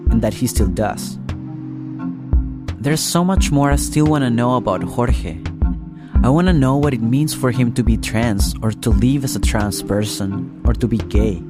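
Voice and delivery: usual speaking voice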